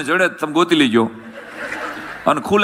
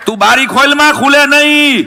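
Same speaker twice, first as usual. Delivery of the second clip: high, raised scolding voice; imitated scolding shout; 7 semitones higher, 5 dB louder than usual. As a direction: call out a sharp order in a retold quarrel. Retold quarrel over a window.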